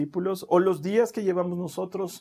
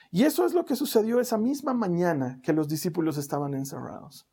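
ordinary talk

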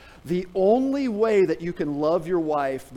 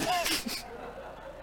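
breathy laugh; 13 semitones higher, 8 dB quieter than usual. Real, not acted. Laughing at own story.

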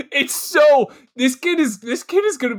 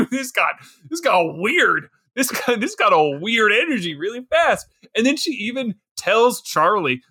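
normal talking voice

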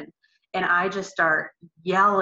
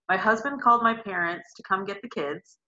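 ordinary talk

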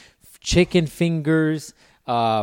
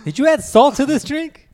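high, louder mock question; mock customer; 13 semitones higher, 6 dB louder than usual. Joking about a salt rim, high and loud mock voice. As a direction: squeak it out like a baffled patron.

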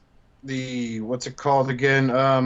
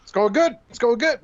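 1 imitating Bobby; 9 semitones higher, 4 dB louder than usual. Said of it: "He's like".

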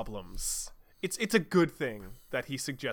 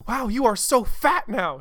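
surprised; 7 semitones higher, 5 dB louder than usual. Acted. Espio reacting to fat Rouge.

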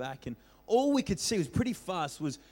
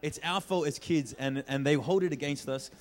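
ordinary talk

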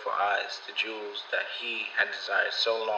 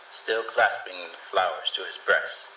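ordinary delivery, nothing marked

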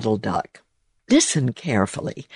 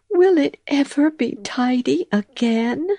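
much higher, plaintive mouse voice; weary mouse; 12 semitones higher than usual. A narrator's reading. Tired mouse wondering about her house, high and plaintive. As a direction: sigh it out. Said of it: "said poor Missus Tittlemouse".

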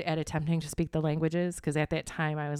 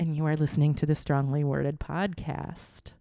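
podcast host intro; 4 dB louder than usual. Acted.

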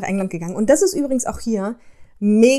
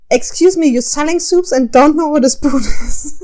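higher, louder, furious English customer; angry customer; 5 semitones higher, 6 dB louder than usual. Retold or imitated. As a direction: snap at the clueless soup girl. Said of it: "flippt sie halt völlig aus". (wütende englische Kundin, höher und lauter)

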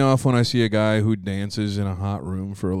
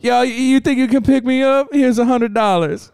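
mock hustler; 12 semitones higher than usual. Mimicking Chris pitching strangers.

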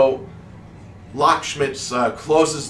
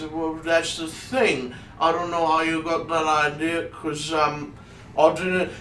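higher, hesitant character voice; reluctant timid gnome; 6 semitones higher than usual. Acted. Gnome telling the wizard he never volunteered, meekly. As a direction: protest meekly in a raised pitch.

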